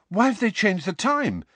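out of breath asking; breathless while running. A narrator's reading. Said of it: "Harry said breathlessly".

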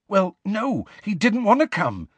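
high-pitched desperate excuse; urgent, defensive desperation. A narrator's reading. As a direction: plead your case, pitched high. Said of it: "sounding desperate".

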